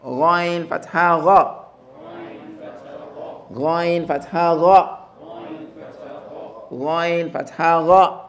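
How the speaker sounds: slow, drawn-out model recitation; teacher drilling slowly; slow spelling drill. Shown as a demonstration. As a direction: spell it slowly for the class to repeat. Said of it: "the heaviness of the ghain".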